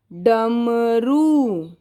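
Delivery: very slow drawn-out डमरू; dictation word. Shown as a demonstration. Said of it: "अगला शब्द लिखेंगे".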